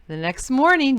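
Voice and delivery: ordinary delivery, nothing marked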